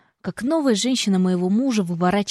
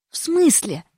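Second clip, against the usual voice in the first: higher, surprised sister voice; 7 semitones higher, slower than usual. A narrator's reading. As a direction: react with sharp surprise. Sister reacting on phone.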